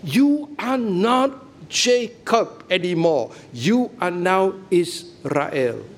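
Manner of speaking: every word weighed slowly; slow, emphatic declaration. Shown as a demonstration. Preacher to congregation, slow emphatic declaration. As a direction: press each word as a solemn new name. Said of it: "Change name".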